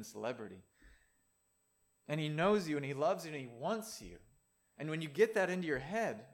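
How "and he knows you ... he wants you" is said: ordinary talk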